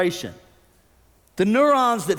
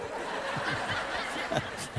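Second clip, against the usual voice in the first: soft unvoiced laugh; breathy laugh; 12 dB quieter than usual. Real, not acted. Pastor laughing at his own made-up punchline, soft laugh. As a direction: laugh quietly at the joke.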